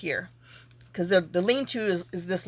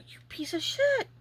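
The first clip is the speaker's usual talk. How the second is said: high whispered curse; whispered insult; 13 semitones higher, 4 dB quieter than usual. Retold or imitated. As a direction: spit a whispered insult at the rooster. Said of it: "And I'm like".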